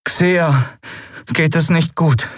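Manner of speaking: put-on high, dejected young voice; high-pitched, heavy-hearted worry; deeply troubled. A narrator's reading. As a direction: break bad news haltingly. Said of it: "Miko klang tief bedrückt".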